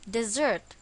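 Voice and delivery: "desert" with the stress on its second syllable, di-ZERT. Shown as a demonstration. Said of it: "the stress is again on the second syllable".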